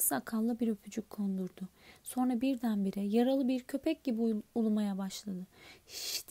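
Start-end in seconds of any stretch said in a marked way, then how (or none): 5.9-6.3 a sharp quiet shush; soft urgent shh; shushing hiss. Acted. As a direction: hush him at once. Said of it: "diye fısıldadı Profesör McGonagall".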